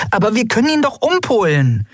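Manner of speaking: very high little voice, eager; small excitable companion. Acted. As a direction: pipe up high with a suggestion. (hohe, aufgeregte Piepsstimme)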